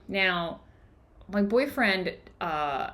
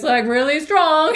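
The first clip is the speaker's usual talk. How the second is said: mock alarm; 5 semitones higher, 6 dB louder, slower than usual. Voicing the growing inner anger.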